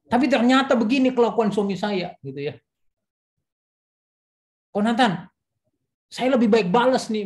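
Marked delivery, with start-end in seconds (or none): 0.1-2.1 higher, louder indignant complaint; upset wife quote. Retold or imitated. 4.7-7.2 higher, louder defiant threat; defiant wife quote. Retold or imitated. Quoting vengeful wife.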